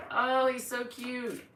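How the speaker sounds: high, drawn-out cooing; gushing adoration. Real, not acted. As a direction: coo slowly over an adorable pet. Gushes over pet.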